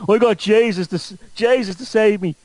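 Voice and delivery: high-pitched mock Dublin junkie; Dublin junkie convert. Dublin junkie turned Pentecostal testifying, mock Dublin voice. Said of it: "some junkie in Dublin goes".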